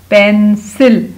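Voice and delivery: Pencil slow and loud, split into पेन and सिल; syllable-by-syllable blending. Shown as a demonstration. Teacher blending pencil for pupils, syllable by syllable. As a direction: break pencil into two stretched syllables, falling at the end.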